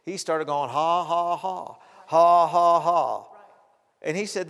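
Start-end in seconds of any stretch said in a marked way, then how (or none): 0.7-3.3 loud, separate, emotionless "ha"s; dry forced laugh. Retold or imitated. Preacher imitates a sick man laughing through symptoms, dry forced laugh. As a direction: fake a laugh with no feeling attached. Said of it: "it was the most dry".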